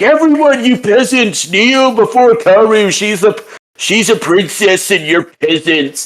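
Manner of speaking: high, hammed-up obnoxious proclamation; obnoxious Tanba. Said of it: "speak all obnoxiously and be like".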